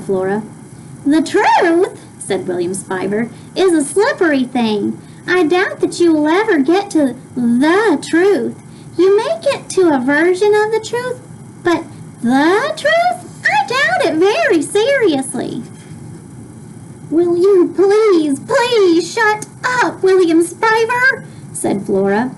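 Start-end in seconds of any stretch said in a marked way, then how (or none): 1.0-2.0 high, lofty know-it-all; a smug boy lecturing; higher, superior boy voice. Acted. William Spiver's voice, read aloud. 3.5-15.6 high, lofty know-it-all; a smug boy lecturing; higher, superior boy voice. Acted. William Spiver's voice, read aloud. 17.1-21.2 slow, high, fed-up plea; girl begging annoying boy to shut up; exasperated plea. Acted. Flora snaps at William.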